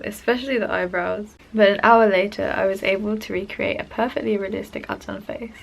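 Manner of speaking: ordinary talk, nothing marked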